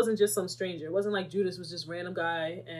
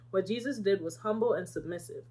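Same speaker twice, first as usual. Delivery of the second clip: ordinary delivery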